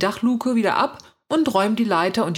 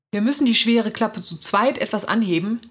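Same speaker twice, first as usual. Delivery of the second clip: ordinary delivery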